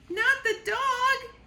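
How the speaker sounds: high, loud, slow panicked yell; terrified yell. Acted. Squirrel yelling at Sugar in terror. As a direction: scream it in horror. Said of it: "yelled the squirrel".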